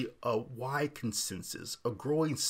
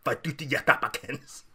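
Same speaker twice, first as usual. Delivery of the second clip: hammed-up foreign accent on Fiducia Supplicans; mock Latinate accent; 4 dB louder than usual. Host sarcastically obliging listeners, exaggerated Latinate accent. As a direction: overdo the Latinate syllables for comic effect. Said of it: "If you wanna hear me say".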